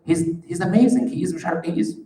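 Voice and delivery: high, loud, insistent defence of a friend; defensive denial. Retold or imitated. Leader defending his helper against complaints, loud and insistent. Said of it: "I might say".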